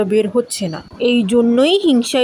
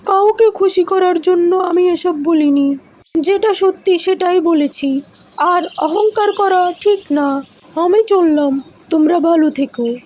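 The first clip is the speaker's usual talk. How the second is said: higher, gentle fox voice weighing both sides; wise fox; 7 semitones higher than usual. Acted. Fox to the two mangoes, settling their quarrel fairly. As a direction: speak as a calm, fair-minded old fox.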